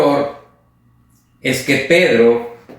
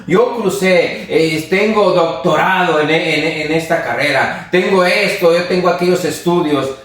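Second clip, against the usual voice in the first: raised, boastful know-it-all voice; a puffed-up churchgoer bragging about his learning; proud boaster; 4 semitones higher than usual. Retold or imitated. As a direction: brag like you know more than everyone. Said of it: "se comparó con los otros diciendo".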